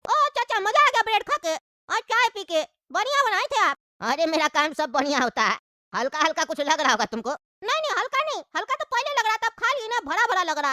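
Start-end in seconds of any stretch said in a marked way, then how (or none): 4.0-7.3 put-on low, easygoing old uncle; lightly lowered uncle voice. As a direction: play the sly old chacha, pitched down. Uncle probes slyly.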